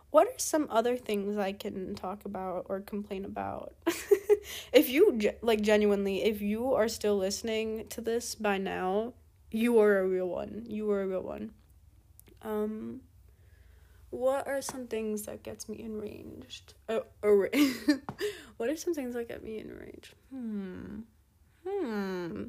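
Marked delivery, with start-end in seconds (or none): 3.8-4.7 high breathy giggle; laugh. Real, not acted. Amused at own complaining. 17.6-18.5 short light laugh. Real, not acted. Amused at own words.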